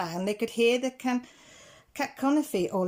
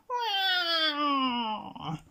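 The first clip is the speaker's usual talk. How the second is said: high, drawn-out falling cat wail; cat yowl; 7 semitones higher than usual. A narrator's reading. Scarface Claw in the tree, a long high yowl. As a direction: wail it like a cornered tomcat. The cat's yowl echoes around.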